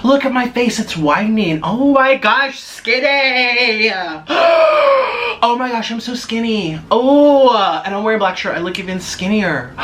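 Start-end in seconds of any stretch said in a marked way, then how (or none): 1.6-6.8 mock astonished shriek. Vlogger seeing her thin face, shrieking in mock shock. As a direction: squeal in fake disbelief at how skinny you look.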